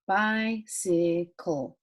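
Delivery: bicycle very slowly, in three separated beats; syllable by syllable. Shown as a demonstration. Teacher reviewing two special sounds, bicycle slowly sounded out. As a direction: draw out bi, cy, cle in bicycle. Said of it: "That's two special sounds also".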